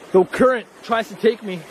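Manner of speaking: ordinary talk, nothing marked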